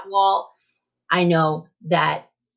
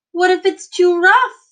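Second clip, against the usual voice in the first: high, loud anxious question; worried question; 9 semitones higher, 6 dB louder than usual. Acted. Teacher voicing a child's doubt, high and anxious.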